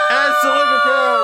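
high, slow exultant shout; excited shout. Acted. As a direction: cry it out to the crowd. Courtier cries out to crowd.

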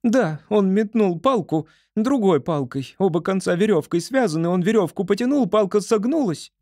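high, quick upset squirrel. A narrator's reading. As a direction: rush it, high and anxious. Squirrel voice continued.

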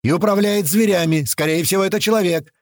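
high, pressing radio call; urgent warning. A narrator's reading. Narrator telling his men of an observer, urgent.

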